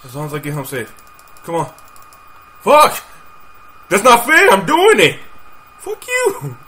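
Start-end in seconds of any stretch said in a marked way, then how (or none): 2.6-5.2 loud, high yell of protest; outraged shout. Real, not acted. Outraged at the game. 5.8-6.6 squealed, very high frustrated curse; high-pitched protest. Real, not acted. Protesting at the game.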